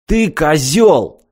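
high, drawn-out indignant cry; mock yell. A narrator's reading. Vanished lad's friend turns on Martin, understated outraged yell. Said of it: "завопил один из дружков исчезнувшего".

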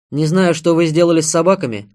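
armed man's forceful caution; firm warning. A narrator's reading. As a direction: call it out firmly. Armed man warns the women.

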